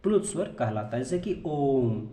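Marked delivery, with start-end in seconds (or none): 1.5-2.1 ओम with the vowel held very long, like a pluta vowel; slow, sustained ओम as a protracted vowel; drawn-out Om. Shown as a demonstration. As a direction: stretch ओम out well past a normal long vowel. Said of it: "इसका उच्चारण इस तरह से होता है".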